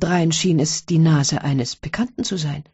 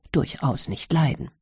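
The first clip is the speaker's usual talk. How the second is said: usual speaking voice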